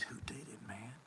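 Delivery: voiceless hushed question; a quiet urgent whisper to a friend. Retold or imitated. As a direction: whisper back, keeping it secret. Whispering back to Coma.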